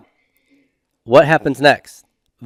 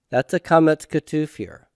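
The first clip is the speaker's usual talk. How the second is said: normal talking voice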